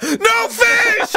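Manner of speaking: high, drawn-out yell; mock outrage. Player protesting Codex's catch, mock outraged high yell.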